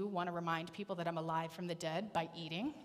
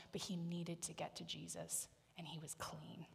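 whisper; 11 dB quieter than usual. Real, not acted. Quiet climax about Peter's cleansing.

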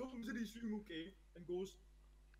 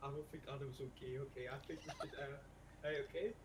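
softly voiced hushed warning, not a true whisper; lightly hushed retelling; mock whisper; 6 semitones lower, 7 dB quieter than usual. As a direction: hush it like you're sneaking a word to a friend. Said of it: "I whisper them out".

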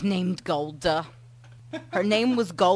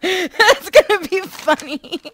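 high, slow, loud words through laughter; can barely get it out for laughing; laughing while trying to speak; 11 semitones higher, 11 dB louder, slower than usual. Real, not acted.

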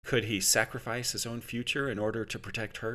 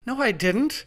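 high, louder protest; 4 dB louder than usual. Acted. Adam protests to the interrogator, high and indignant. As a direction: insist you didn't say it. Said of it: "Adam protested".

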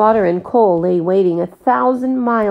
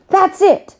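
very high, loud cry of discovery; excited; 13 semitones higher, 5 dB louder than usual. A narrator's reading. Jack cries out his idea to Praiseworthy, high and thrilled. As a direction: blurt out a brilliant idea.

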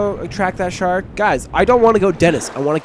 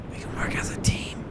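whispered plea to team up; coaxing whisper; 11 dB quieter than usual. Pretend. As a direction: whisper it to win the turtle over. Coaxing the turtle.

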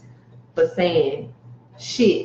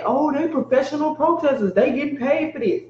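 mocking heckler; 9 semitones higher than usual.

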